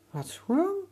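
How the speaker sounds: high, curious question; curious boy. Acted. Boy asks the sick alligator, high and curious. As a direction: ask with a boy's bright concern. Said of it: "Emir asked".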